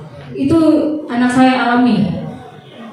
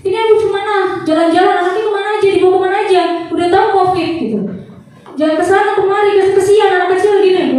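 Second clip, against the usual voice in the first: high-pitched mock scolding, rushed at the end; shrill-free, high retold telling-off from a doctor; 8 semitones higher, faster than usual. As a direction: imitate the doctor scolding a careless mother. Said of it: "saya dimarahin".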